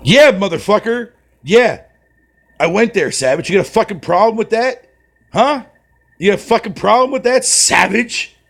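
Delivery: high, loud hammed-up trash talk; mock tough taunt. Streamer goading his friend Savage in chat, mock aggressive. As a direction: egg him on in a playful bully voice.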